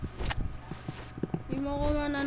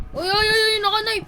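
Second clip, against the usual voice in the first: higher, louder alarmed calls; rapid alarmed uy calls; excited alarm; 8 semitones higher, 4 dB louder than usual. Real, not acted. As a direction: sound the alarm, something's coming. Alarmed at a knife in game.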